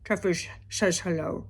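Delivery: slurred cat voice, s's mushed into sh; Truffles voice. Acted. Owner voicing the cat saying hello, slurred cat voice. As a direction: talk as the pet cat with mushy, slushy s sounds. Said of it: "to do it in my Truffles voice".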